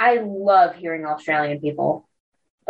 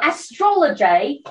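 put-on Australian accent; 7 semitones higher than usual. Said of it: "my Australian accent blows".

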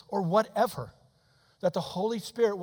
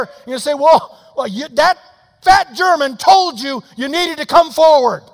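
loud, higher and pressing, fast in places; fervent preaching; 7 semitones higher, 11 dB louder than usual. Acted. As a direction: drive the point home to the congregation. Preacher presses the altar call.

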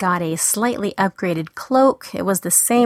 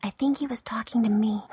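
whispered aside; 2 semitones higher, 6 dB quieter, faster than usual. Real, not acted. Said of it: "aside to listeners".

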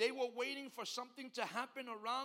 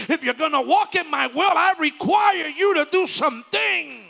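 much louder and higher, shouting the sermon; preaching at full force; 7 semitones higher, 21 dB louder than usual. Acted. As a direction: preach it out loud to the whole church. Preacher builds to shouting climax.

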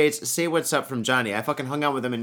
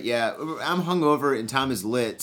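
usual speaking voice

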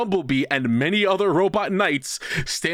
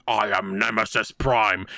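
slow, deep evil robot announcement; villain robot voice; 7 semitones lower than usual. Retold or imitated. Brainwashed robot leader announces his new evil name, slow and deep. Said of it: "and going".

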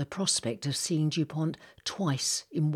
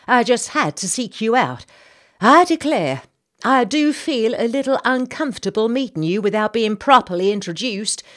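much higher, louder gossiping Southern lady; chatty society lady; 7 semitones higher, 10 dB louder than usual. Acted. Senator's wife introducing herself to the captain, high and gushing. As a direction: gush it out like a busybody hostess. Mrs Bartlett continues.

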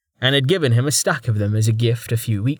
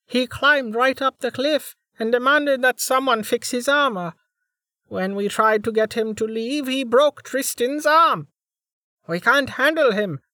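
put-on high, worried girl reporting; a flustered young girl explains; 12 semitones higher than usual. A narrator's reading. Ellis reporting anxiously.

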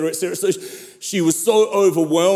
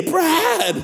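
much higher, hammed-up call across a room; imitated calling out; 14 semitones higher than usual. Lady interrupting a dignified ambassador's meeting, imitated calling out. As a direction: interrupt with a high, mock holler of the name. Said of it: "call across the room".

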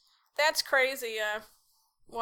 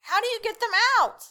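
surprised; 9 semitones higher, 3 dB louder, faster than usual. Acted. Host reacts to snake story.

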